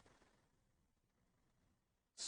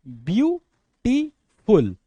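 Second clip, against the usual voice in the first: Beautiful in three slow, separate beats; broken into syllables; 2 semitones higher, 3 dB louder, much slower than usual. Shown as a demonstration. Teacher showing students what a syllable is, beautiful syllable by syllable. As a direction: break beautiful into three parts with clear pauses. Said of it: "break करके use कर रहे होते हैं".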